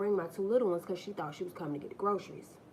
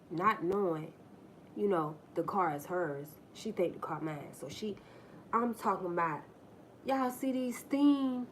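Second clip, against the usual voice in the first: ordinary talk, nothing marked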